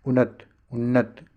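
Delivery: ordinary delivery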